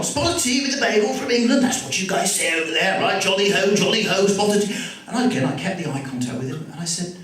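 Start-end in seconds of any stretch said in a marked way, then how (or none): 0.0-4.7 high-pitched fake English accent, jolly and insistent; mock English accent. Shop owner persisting at an English customer, mock English accent. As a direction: keep up the bad English impersonation. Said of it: "And he just carried on".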